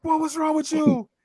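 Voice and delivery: high sharp mom voice; mama bursting in to scold; scolding mother. Retold or imitated. Mother bursting into the room, high scolding voice. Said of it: "my mother came in there".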